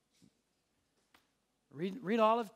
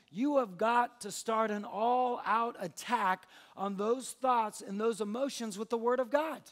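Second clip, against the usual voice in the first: forceful preaching; 3 semitones higher, 5 dB louder than usual. Acted. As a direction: press the point home like a preacher.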